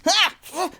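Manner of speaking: high, loud pained yelp. Acted. Tipsy bitten on the clavicle, high yelp. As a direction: yelp as the bite lands. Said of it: "and Tipsy".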